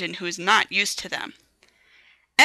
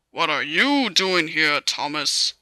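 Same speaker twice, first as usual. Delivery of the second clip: lower, slow stern question; stern controller; 4 semitones lower, slower than usual. A narrator's reading. The fat controller sternly questioning Thomas, low and slow. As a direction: question him like a stern boss. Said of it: "he asked sternly".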